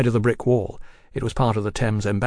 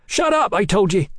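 raised, high-pitched angry cry; 9 semitones higher, 4 dB louder than usual. A narrator's reading. Young brother silencing the leering prisoner, crying out. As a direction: cry it out angrily. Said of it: "Tom cried".

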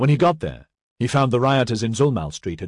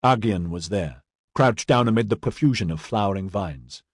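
plain reading voice

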